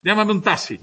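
"taxi" pronounced tasi, no K sound. Shown as a demonstration. Teacher imitating northern speakers, X said as S. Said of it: "Pero ellos lo pronuncian taxi".

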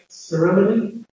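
ceremony with a weak schwa in the third syllable; RP pronunciation. Shown as a demonstration. Teacher showing RP, "ceremony" with schwa before the final nee. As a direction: reduce "ceremony" to CER-uh-muh-nee. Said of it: "The R P word, uh, uh, has a schwa".

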